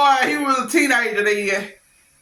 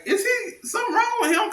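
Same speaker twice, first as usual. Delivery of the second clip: incredulous; 13 semitones higher, 4 dB quieter than usual. Retold or imitated. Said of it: "I was like".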